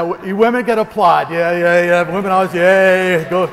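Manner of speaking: loud whooping yays, a rally cheer; loud celebratory cheer; cheering crowd. Acted. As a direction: whip the women up into applause.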